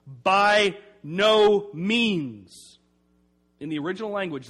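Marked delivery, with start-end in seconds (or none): 0.1-2.8 slow, loud, drawn-out "By no means"; emphatic refusal. Shown as a demonstration. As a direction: stamp out "By no means" slowly, with full weight. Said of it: "strongest possible way to say no".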